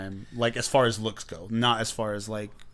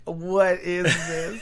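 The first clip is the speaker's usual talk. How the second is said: slow, higher, laughing bewilderment; baffled disbelief; 7 semitones higher, 3 dB louder, much slower than usual. Retold or imitated. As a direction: stretch it out in confusion. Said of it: "Just sitting there like".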